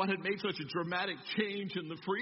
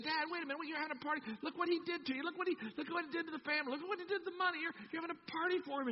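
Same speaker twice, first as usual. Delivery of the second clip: high, whiny protest; resentful older brother; 11 semitones higher, faster than usual. Acted. Older brother protesting to Dad about the party, high and whiny. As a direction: plead it like a jealous sibling. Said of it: "he said".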